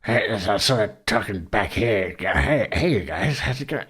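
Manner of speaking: goblin guy voice from the top of the throat; rough demo of the goblin guy Bond, slow start. Shown as a demonstration. GM shows the crew Bond's voice, goblin guy from the throat. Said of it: "I haven't done the voice for ten months".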